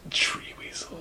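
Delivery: barely audible, eerie whisper; whispered curse. Acted. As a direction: whisper it like a hex. Imitating the whispered Thinner curse.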